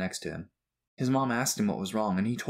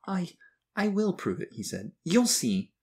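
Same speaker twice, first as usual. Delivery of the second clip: put-on much higher, halting boy's voice; tearful defiance; 10 semitones higher, slower than usual. A narrator's reading. Crying boy answers his bully, tearfully defiant. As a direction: insist through a touch of tears. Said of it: "he wiped the tears".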